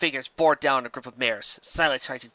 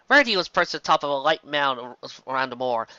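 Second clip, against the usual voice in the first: ordinary delivery, nothing marked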